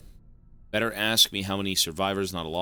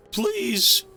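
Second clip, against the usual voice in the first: very high squeaky begging; mock plea; 6 dB louder than usual. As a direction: squeal it like a cartoon beggar. Mocking plea.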